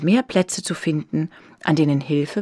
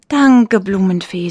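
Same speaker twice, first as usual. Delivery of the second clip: joyful; 8 dB louder, slower than usual. A narrator's reading. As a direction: thank the fairy gladly. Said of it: "sagte sie freudig".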